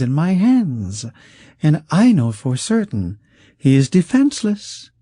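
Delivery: high, smooth, coaxing voice of a wealthy schemer; silky rich man. Acted. Wealthy schemer coaxing the outlaw, high and silky. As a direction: purr it out like a sly patron who holds all the cards.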